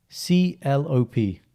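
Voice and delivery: spelling out letters slowly; sounding out words. Shown as a demonstration. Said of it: "Say, say this medical drug".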